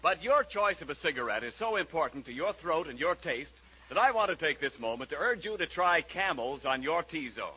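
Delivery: ordinary talk, nothing marked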